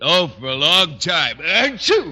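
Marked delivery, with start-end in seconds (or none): 1.4-2.1 loud hammed-up sneeze, higher; fake sneeze. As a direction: force out a showy achoo. Fake sneeze in Conklin's office.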